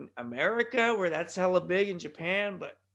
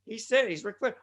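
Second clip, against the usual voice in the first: usual speaking voice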